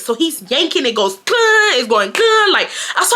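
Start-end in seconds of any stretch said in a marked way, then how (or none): 1.2-1.7 high mimicked clunk of a yanked door; sudden high sound effect; imitated door noise. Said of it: "like, it makes that noise". 2.1-2.5 high mimicked clunk of a yanked door; sudden high sound effect; imitated door noise. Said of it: "like, it makes that noise".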